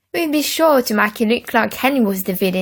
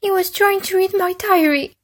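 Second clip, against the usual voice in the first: put-on high tattling boy; 10 semitones higher than usual. A narrator's reading. Peter tattles.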